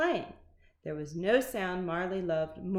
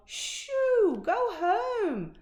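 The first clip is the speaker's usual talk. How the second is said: high, loud shooing cry; shrill lunch lady shout; 13 semitones higher, 5 dB louder, much slower than usual. A narrator's reading. Lunch lady yelling at the dog to shoo. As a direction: chase the dog off with a yell. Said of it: "lunch ladies yelled".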